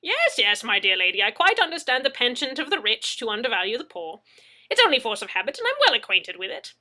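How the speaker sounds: hearty, waving it all away; Mad Zeke voice. A narrator's reading. Mad Zeke answers Anna, loud and jovial.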